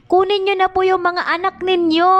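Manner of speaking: high, sustained mimicked teacher announcement; imitated teacher. Teacher telling parents to collect their children, mimicked in a high call. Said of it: "Imingon dayon".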